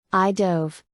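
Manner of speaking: usual speaking voice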